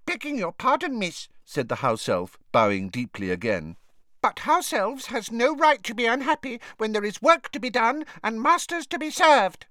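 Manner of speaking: high squeaky elf, humble and formal; lightly voiced bowing elf; polite house elf. A narrator's reading. A house elf politely disagrees with Hermione, humble.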